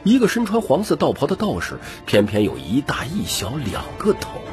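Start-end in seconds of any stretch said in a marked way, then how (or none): none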